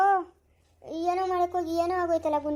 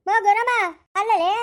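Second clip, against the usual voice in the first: calling out; 3 semitones higher, 5 dB louder than usual. Acted. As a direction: shout her name across the yard. Calling to fallen woman.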